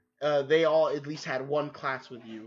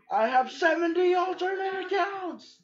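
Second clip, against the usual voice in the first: squeaky villain voice; 14 semitones higher than usual. Acted. Villain boast joke.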